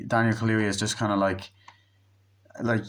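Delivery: ordinary delivery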